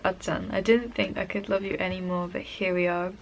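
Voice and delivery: ordinary talk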